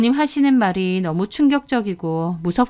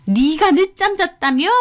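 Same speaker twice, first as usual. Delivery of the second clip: high, angry screech down the phone; shrieking mother-in-law; 8 semitones higher than usual. A narrator's reading. Mother-in-law confronts daughter-in-law about oversleeping, angry high shriek. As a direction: shriek it as an outraged accusation. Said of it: "하면서 소리를 빽 지르시는 겁니다".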